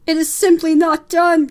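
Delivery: high, very loud scandalised aunt; Aunt Florence. Acted. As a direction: proclaim it as an outraged aunt. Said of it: "came Aunt Florence's voice from the crowd".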